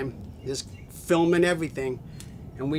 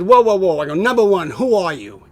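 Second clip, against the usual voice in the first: loud, higher-pitched mock protest; retold interruption; 3 semitones higher, 9 dB louder than usual. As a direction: cut in loudly to halt someone. Retold halting of the questioner.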